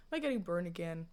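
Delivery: ordinary delivery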